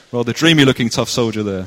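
usual speaking voice

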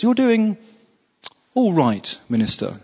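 a hint of a measured civil servant, pausing before all right; carefully considered reply. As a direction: weigh each word, then land on all right. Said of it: "considers very carefully and says".

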